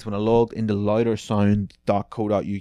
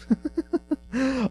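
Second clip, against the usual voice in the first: a sudden high burst of laughter. Real, not acted. Host laughing at a cringe Reddit answer, real laugh. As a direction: crack up at the answer.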